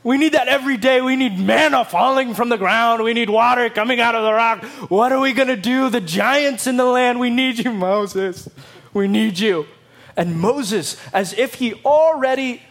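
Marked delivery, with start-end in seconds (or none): 0.0-9.7 voicing the desperate Israelites, higher and louder; anxious crowd begging their leader; pleading crowd. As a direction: beg like a frightened people.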